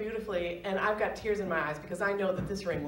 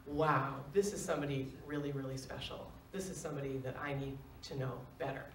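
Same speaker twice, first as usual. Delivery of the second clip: much softer, lower awed thought; hushed inner thought; 6 semitones lower, 7 dB quieter than usual. Retold or imitated. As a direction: think it to yourself in wonder. Inner thought on meeting Ben.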